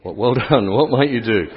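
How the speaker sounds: usual speaking voice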